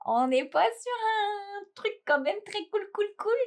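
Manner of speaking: high, singsong delight; playful high-pitched voice. Real, not acted. Tea lover gushing over her advent gift, high playful voice.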